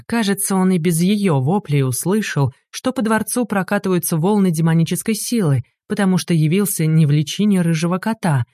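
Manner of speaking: ordinary delivery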